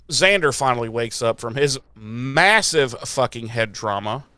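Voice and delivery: normal talking voice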